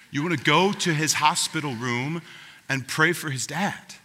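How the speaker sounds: ordinary talk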